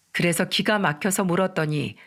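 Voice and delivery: ordinary delivery, nothing marked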